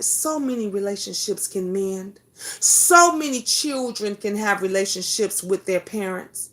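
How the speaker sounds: usual speaking voice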